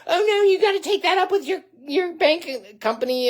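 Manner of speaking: high, whiny mock voice of the debt collector; high mocking impression of a brushed-off phone agent. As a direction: squeak it out like a dismissive clerk. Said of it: "and all this kind of stuff".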